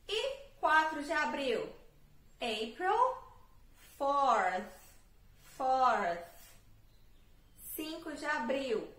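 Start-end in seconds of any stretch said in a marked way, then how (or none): 2.4-6.3 "fourth" with a louder, breathy th at the end. Shown as a demonstration. Teacher models the final th for students. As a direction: finish "fourth" with air through the teeth. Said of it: "colocar o T H no final".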